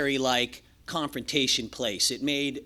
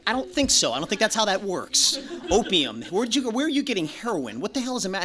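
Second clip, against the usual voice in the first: mock counselor grilling; 5 semitones higher than usual. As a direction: play the exasperated counselor calling his bluff. Staff grilling him over drugs.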